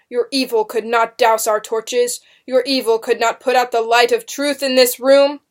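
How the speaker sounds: loud, emphatic accusation; accusing declamation. A narrator's reading. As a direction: hurl the charge at the prisoners. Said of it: "He turned to Susanna and her mother".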